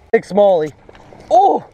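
high, loud burst of excitement; real high-pitched excited holler; excited catch call. Real, not acted. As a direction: shout out in real thrill at the catch. Hooking a big fish.

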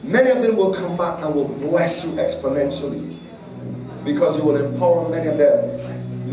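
fervent prophecy shouted over the congregation; preaching at full force. Acted. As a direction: proclaim it over her, rising to a crescendo.

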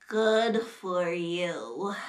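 low, very slow, weary reply; unimpressed sick Piggy. Acted. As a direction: drag it out low and miserable. Piggy has caught the cold, unimpressed.

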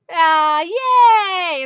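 high, loud happy yay; loud delighted yay; cheer. Real, not acted. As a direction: cheer for him. Cheering a viewer's news.

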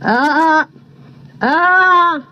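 drawn-out crow caw. A narrator's reading. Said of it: "said Mister Crow".

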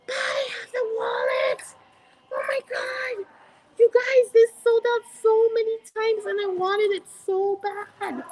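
high-pitched excited squeal, held all through; super excited. Real, not acted. Vlogger spots the wallet she badly wanted, super excited. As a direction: gush in a very high voice. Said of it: "I got super excited".

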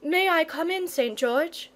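a mild courteous request; polite boy. Acted. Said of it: "said the boy politely".

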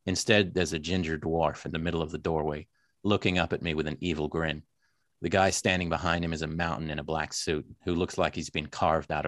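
low, film noir narration in an American accent. Shown as a demonstration. Author reading own novel aloud to host, American accent. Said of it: "I have to talk slowly in American".

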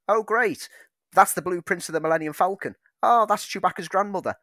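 high, loud excited fan parody; mocking fanboy voice. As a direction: gush sarcastically over trivia. Mocking fans of legacy references.

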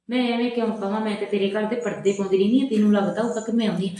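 ordinary talk, nothing marked

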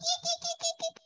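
bat squeak. Shown as a demonstration. Teacher giving the bat's sound as a riddle for the children, squeaking. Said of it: "they go eek".

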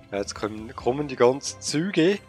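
ordinary delivery

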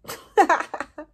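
high, loud laugh; burst of laughter. Real, not acted. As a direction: laugh out at herself. Laughing at her own confession.

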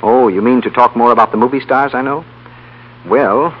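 ordinary talk, nothing marked

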